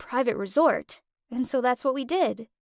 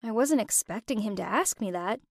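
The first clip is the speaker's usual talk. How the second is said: ordinary delivery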